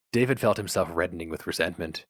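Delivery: plain reading voice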